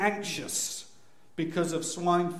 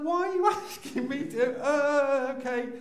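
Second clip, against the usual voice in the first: high-pitched, squeaky protest; mock-panicked, reluctantly giving in; flustered panic; 9 semitones higher than usual. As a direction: squeal it, horrified to be asked. Flustered father asked to cut cord.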